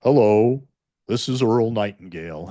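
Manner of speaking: deep, gravelly radio-speaker voice; deep and gravelly, in a grand speaker's manner; Earl Nightingale. Acted. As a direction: drop low into a deep gravelly tone. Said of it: "he's got this deep, gravelly voice".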